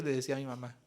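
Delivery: normal talking voice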